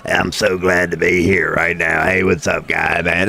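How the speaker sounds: low, strained RFK voice; raspy old politician imitation; RFK impression. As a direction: croak it out like RFK. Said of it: "this sumbitch be talking like this".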